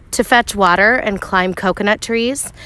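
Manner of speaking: ordinary talk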